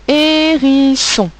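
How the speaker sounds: hérisson drawn out slowly, pitched high; dictation voice. Shown as a demonstration. Teacher dictating hérisson to the class, slow and high. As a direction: stretch hérisson slowly and clearly for pupils. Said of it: "dictating the word".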